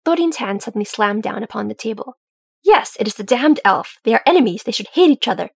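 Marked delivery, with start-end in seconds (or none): 2.6-5.5 angry, louder outburst; heated character protest, loud and insistent; temper flaring. A narrator's reading. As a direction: let the irritation push the volume up. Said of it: "his temper flaring".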